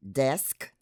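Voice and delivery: desk with the full sk cluster; mainstream desk. Shown as a demonstration. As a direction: say desk with both s and k clear. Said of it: "pronounced as in the following words".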